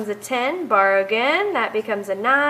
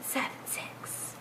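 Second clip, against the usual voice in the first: whisper; 20 dB quieter than usual. Real, not acted. Counting down under breath.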